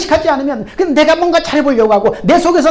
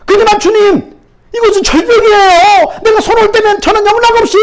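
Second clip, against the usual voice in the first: much higher and louder, aghast; shocked alarm; 9 semitones higher, 6 dB louder than usual. Acted. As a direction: recoil in horror at the command. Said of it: "소스라치게 놀랍니다".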